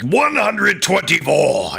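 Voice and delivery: imitating the darts announcer calling a score. Vlogger mimicking the arena darts announcer, drawn-out call. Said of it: "I don't think I can do the accent".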